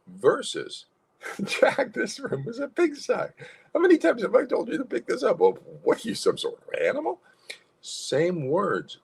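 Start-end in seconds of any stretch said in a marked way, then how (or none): 1.4-7.2 much higher, smiling mock scolding; happy scolding. Shown as a demonstration. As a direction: scold playfully with a laugh in the voice. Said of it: "you can add the happy to the angry emotion".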